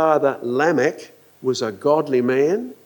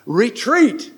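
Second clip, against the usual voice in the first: high, ringing voice of God; booming divine command, pitched high; thunderous command; 8 semitones higher than usual. Acted. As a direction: order the waters back with mock thunder. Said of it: "his voice was like thunder".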